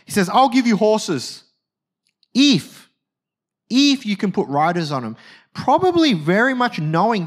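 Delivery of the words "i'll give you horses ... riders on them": teasing offer, pausing round "if"; mocking. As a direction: dangle the bargain, pause, then hit "if". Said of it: "he mocks Hezekiah".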